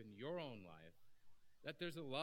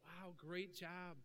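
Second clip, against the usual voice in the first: higher, slow, drawn-out praise; lightly hammed-up delight; mock congratulating; 6 semitones higher, much slower than usual. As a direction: stretch out the compliment. Congratulating in acted scene.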